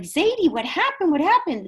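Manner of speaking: mock upset, urgent questioning; retold distressed cry. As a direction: act out a grandson crying out in concern. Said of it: "and he's crying".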